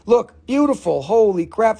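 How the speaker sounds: ordinary talk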